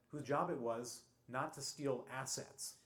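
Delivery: slow, weighted delivery landing hard on "assets"; stress on assets. Real, not acted. Explaining the new CEO's job to the audience, slow with "assets" stressed. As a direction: lean on the word "assets", slowing the whole line. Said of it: "I stress the word assets".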